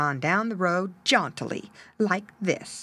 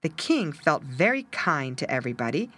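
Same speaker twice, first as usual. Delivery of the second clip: ordinary delivery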